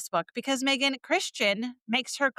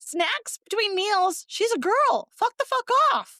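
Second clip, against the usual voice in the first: outraged yelling; 10 semitones higher, slower than usual. Real, not acted. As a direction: let the outrage burst out. Outraged at no-snacks rule.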